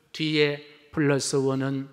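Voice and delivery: ordinary talk, nothing marked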